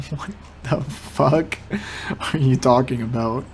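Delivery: ordinary delivery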